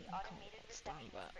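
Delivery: low, quiet grumble; mock hater. Imitating a viewer who hates her accent, low and quiet. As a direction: mutter like a confused listener. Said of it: "you'd be like".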